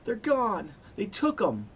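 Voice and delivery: high-pitched tearful lament; grieving, distraught woman; acted crying. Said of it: "She starts, like, crying, sniffing".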